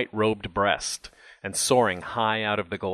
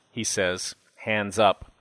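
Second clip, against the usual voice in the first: ordinary delivery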